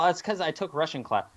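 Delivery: ordinary delivery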